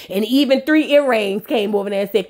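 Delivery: ordinary delivery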